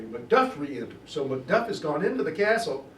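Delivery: ordinary talk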